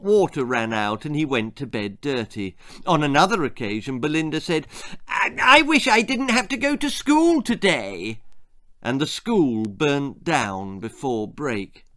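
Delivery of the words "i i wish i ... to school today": put-on girl's voice. A narrator's reading. Belinda voiced as a child.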